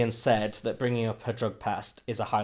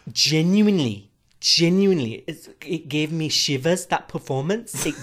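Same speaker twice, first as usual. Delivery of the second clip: mock earnest gush; 4 semitones higher, 4 dB louder than usual.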